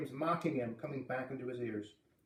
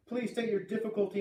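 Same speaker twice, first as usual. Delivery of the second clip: raised-pitch official radio request; radio operator; 7 semitones higher than usual. A narrator's reading. Radio operator asking caller for his difficulty, raised official voice. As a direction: ask formally and clearly over a poor link. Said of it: "The voice was very faint and breaking up".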